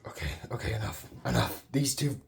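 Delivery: usual speaking voice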